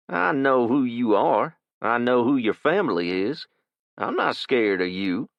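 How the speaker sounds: put-on a kid showing he isn't scared; cocky kid. Kid taunts Jason.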